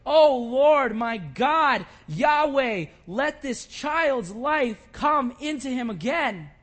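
loud, pleading prayer, slowing at the end; crying out to God. Retold or imitated. Elijah begging God to revive the child, loud and pleading. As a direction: beg God for the child's life at full voice. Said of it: "and he cried to the Lord".

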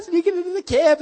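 high, loud mock-sneaky voice; giddy sneaking. Telling how they creep into Saul's camp, giddy and high. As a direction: play it high and hammed-up.

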